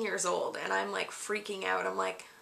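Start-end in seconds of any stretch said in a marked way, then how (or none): none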